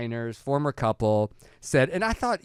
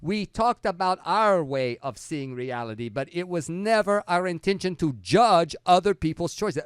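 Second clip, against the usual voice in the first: mock Italian; 6 semitones higher, 4 dB louder than usual. Reading the designers' follow-up.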